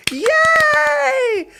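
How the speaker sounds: high, loud joyful yay; cheer. Real, not acted. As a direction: shout a happy cheer. Cheers at donation.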